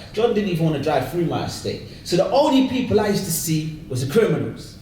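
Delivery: usual speaking voice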